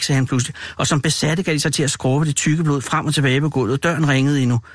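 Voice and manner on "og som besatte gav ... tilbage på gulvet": plain reading voice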